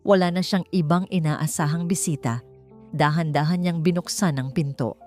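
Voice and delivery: plain reading voice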